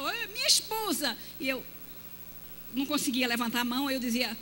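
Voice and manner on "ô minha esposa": high, bright presenting voice; husband's proud introduction. Retold or imitated. Husband introducing his wife, high proud voice. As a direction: show off your wife warmly. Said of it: "Abraçar".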